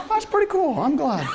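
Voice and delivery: understated, mild approval; calm and reserved. Retold or imitated. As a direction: play down the joy, imitating a reserved dad. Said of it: "calm and reserved".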